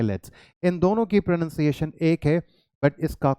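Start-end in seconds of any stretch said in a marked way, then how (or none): 0.0-0.3 "लेट्स" said as one syllable, lower; contracted form. Shown as a demonstration. Teacher shows how to say "लेट्स", contracted form. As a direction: model "लेट्स" run together as a contraction. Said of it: "pronunciation contracted form में रखेंगे".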